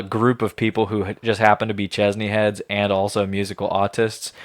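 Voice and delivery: ordinary delivery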